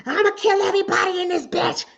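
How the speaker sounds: mock angry caller. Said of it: "I get people that will bang on me".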